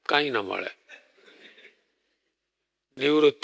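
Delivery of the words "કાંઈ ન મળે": much lower and softer, sinking away; quiet, dropped refrain. Acted. Preacher to congregation, sinking the refrain low and soft. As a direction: let the words fall quiet and low.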